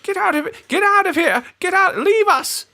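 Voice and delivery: put-on very high shrieking old lady; screeching old crone chasing intruders; old woman voice. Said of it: "That's my best old woman voice I've got".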